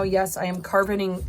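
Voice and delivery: usual speaking voice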